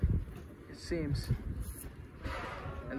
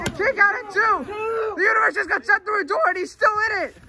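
higher, louder, fast disbelief; excited shout; 8 semitones higher, 4 dB louder than usual. Acted. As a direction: blurt it out in disbelief. Commentator shocked by the kick out.

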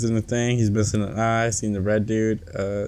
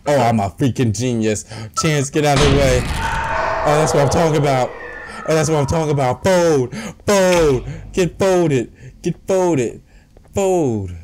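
triumphant shouting; 6 semitones higher, 5 dB louder than usual. Real, not acted. Triumphant hit on the enemy.

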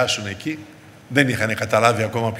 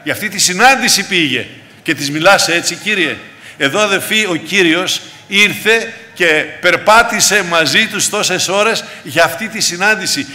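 raised, emphatic sermon delivery; impassioned preaching; 7 semitones higher, 5 dB louder than usual. Acted. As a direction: press each phrase home to the congregation. Preacher rises into forceful preaching.

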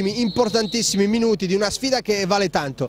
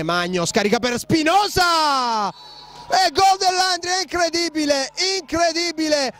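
breathless italian goal commentary; 8 semitones higher than usual. Real, not acted. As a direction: shout the goal, barely containing it. Goal scored late in match.

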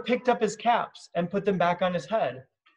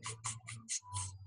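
soft unvoiced tongue clicks; monkey tsk-tsk; 21 dB quieter, faster than usual.

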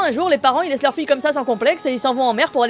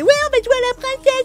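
put-on much higher, bright little-girl voice; squeaky mock kid voice begging to play princess; childish little sister; 12 semitones higher than usual.

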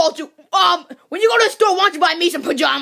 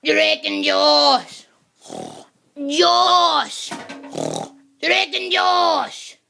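very slow, drawn-out sleep talk; dreamy, stretched-out words from deep sleep; 3 semitones lower, much slower than usual. Acted. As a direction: drone it out between snores. Mumbling in sleep between snores.

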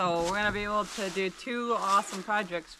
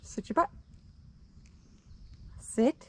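firm dog command; 4 semitones higher than usual. Real, not acted. Owner firmly tells the dog to sit.

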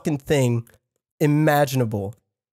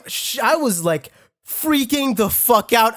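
ordinary delivery

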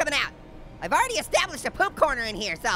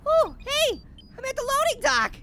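ordinary talk

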